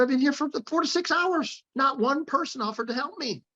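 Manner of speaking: high, pleading retelling of her tears; imitating a tearful woman. Stranded woman tells her rescuer of hours waiting, tearful and high. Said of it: "She started crying and she said".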